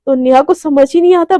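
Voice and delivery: plain reading voice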